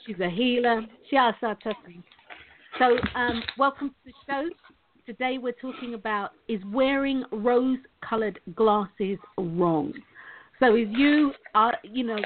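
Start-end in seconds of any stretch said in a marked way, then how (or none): 6.5-9.9 each word drawn out slowly to set up the question; slow topic announcement. Real, not acted. Host slowly announcing the day's topic to listeners. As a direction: spell out the big question of the day slowly.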